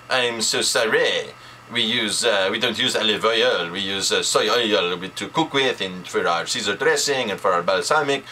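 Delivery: imitated French waiter, apologizing; French-accented waiter owning up, a little halting. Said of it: "the waiter in his French accent".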